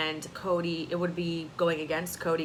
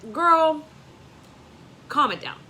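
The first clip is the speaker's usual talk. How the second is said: sassy call-out; 9 semitones higher, 8 dB louder than usual. Real, not acted. Vlogger scolding Nicole through the screen, sassy and loud. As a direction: call her out with attitude.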